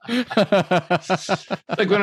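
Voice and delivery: slow, laughing through the words; real laughter through a crude joke. Real, not acted. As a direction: laugh out the line slowly.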